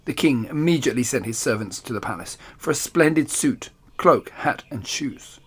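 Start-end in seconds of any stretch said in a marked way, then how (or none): none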